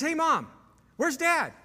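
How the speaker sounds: put-on high, boyish call to his mom; little boy voice. Grown son greeting his mother like a little boy. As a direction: sound like a small kid calling for Mom. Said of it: "Just like a little boy".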